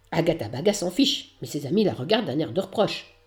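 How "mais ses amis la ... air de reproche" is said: plain reading voice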